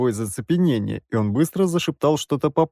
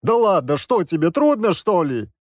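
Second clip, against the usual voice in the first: higher, louder, pestering dad; pushy father; 7 semitones higher, 3 dB louder than usual. A narrator's reading. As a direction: coax him, losing patience. Father pressing the narrator.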